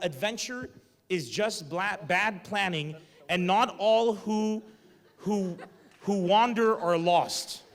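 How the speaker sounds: slow, loud, grandly drawn out; hammed-up inspirational slogan, very slow; mock-profound Instagram quote. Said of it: "You're a walking Instagram cliche".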